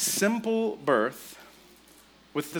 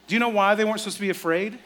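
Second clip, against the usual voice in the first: ordinary talk, nothing marked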